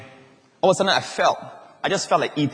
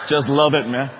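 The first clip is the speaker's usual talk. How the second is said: usual speaking voice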